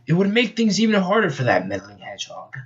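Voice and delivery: gloat, then cackle; gloating villain. Acted. Eggman plots against the hedgehog, gloating.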